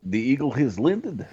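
put-on Russian buzz-in; Russian accent. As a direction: play the answer in a fake Russian accent. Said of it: "for doing the Russian accent".

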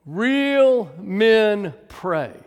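REAL stretched very long and loud, then MEN, then PRAY; very slow, loud, drawn-out emphasis on REAL; emphatic repeat. Real, not acted. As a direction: hammer each word slowly and loudly. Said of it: "Third time for emphasis".

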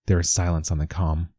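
plain reading voice

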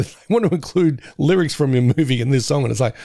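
usual speaking voice

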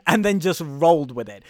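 ordinary delivery

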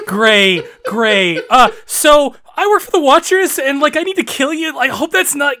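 cheery shop assistant voice; customer service voice. Retold or imitated. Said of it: "customer service voice in my head".

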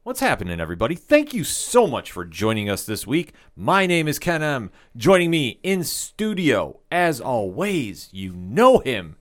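slow, drawn-out host introduction; big welcome with long pauses and punched words; show announcer. Acted. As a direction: stretch each title out like an announcer.

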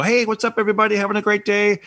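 high, bright greeting to camera; cheery vlogger voice. Retold or imitated. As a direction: hype up the viewers. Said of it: "I'll go".

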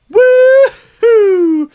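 loud celebratory woohoo; cheer. Real, not acted. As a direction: whoop like the team just scored. Cheering the cannabis bill news.

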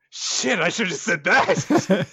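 a guilty guy realising his alibi failed; caught-out mutter. Retold or imitated. Jesse realising he blew his cover, mock guilty panic. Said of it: "Jesse's just like".

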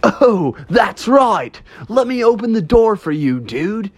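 high, easygoing dude ghost; surfer-dude ghost. Acted. Ghost offering to open the door, high chummy dude voice. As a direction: play a chummy ghost who just remembered.

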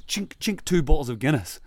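high clinking noises, then a loud reveal; gleeful re-enactment. Retold or imitated. Storyteller revealing the consul's hidden Guinness, gleeful. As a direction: present it like a triumphant treat. Miming bottles produced with relish.

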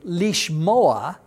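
lishmoa drawn out as leesh-MO-a; Hebrew pronunciation. Shown as a demonstration. Teacher giving the Hebrew verb to hear, Hebrew pronunciation. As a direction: stress MO in lishmoa. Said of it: "a Hebrew word in Hebrew".

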